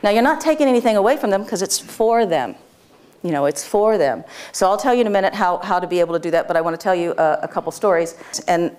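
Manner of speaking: ordinary talk, nothing marked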